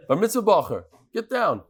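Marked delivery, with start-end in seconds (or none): none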